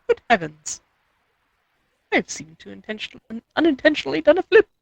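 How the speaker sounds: a hint of posh English voice; lightly voiced posh English accent. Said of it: "in a very English posh voice".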